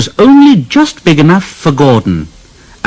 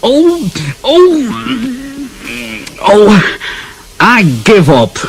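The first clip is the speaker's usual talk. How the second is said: high crying oh oh of despair, then giving up; exasperated wail; 10 semitones higher, much slower than usual. Acted. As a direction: wail in defeat, then surrender. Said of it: "Gordon's driver said".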